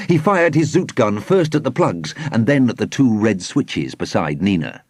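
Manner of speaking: ordinary delivery, nothing marked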